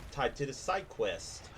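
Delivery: usual speaking voice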